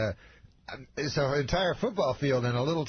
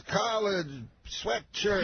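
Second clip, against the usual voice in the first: high voice, one word at a time; Bill Cosby; 9 semitones higher, slower than usual. Retold or imitated. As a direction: comedian impression with a pause between words. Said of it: "He talks, like, just in words".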